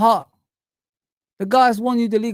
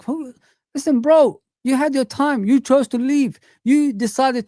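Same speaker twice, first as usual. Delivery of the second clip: fed-up fan raising his voice at Klopp; heated rant; 6 semitones higher than usual. Real, not acted. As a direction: vent at him like he's in the room.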